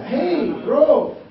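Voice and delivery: loud, slow Indonesian-cowboy English; imitating a pastor's cowboy English; cowboy accent. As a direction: stretch each word like a Texan. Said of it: "bahasa Inggrisnya dia masih bahasa cowboy".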